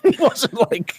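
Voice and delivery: squeaky high laugh through the words; cracking up mid-sentence. Real, not acted. Laughing retelling.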